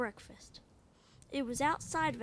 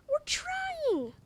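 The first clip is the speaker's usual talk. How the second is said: panicked shout; 4 dB louder than usual. Acted. Kids panicking at door.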